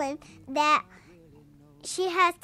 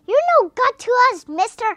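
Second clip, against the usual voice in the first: loud, higher, fast defiance; defiant shout; 7 semitones higher, 12 dB louder than usual. Retold or imitated. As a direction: fling it back at the giant god. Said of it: "Kirk tells him".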